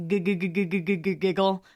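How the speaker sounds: "giggle" with its first G repeated like a stammer; stuttered G's. Shown as a demonstration. Vlogger playing on the G's in "giggle", stuttering.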